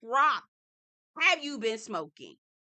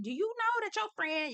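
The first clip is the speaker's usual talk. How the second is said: pitched-up outraged ask; high indignant question; 10 semitones higher than usual. Retold or imitated. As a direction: voice jumps up on know. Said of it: "I'd be like".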